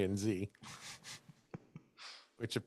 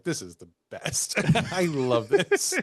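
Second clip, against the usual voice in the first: delighted townsperson; slower than usual. Retold or imitated. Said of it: "everyone in Harper's Ferry is like".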